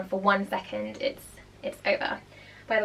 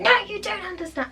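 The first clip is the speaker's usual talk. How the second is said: mock angry teen; 11 semitones higher than usual. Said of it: "they're gonna be like".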